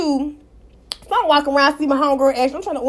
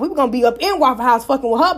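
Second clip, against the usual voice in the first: ordinary delivery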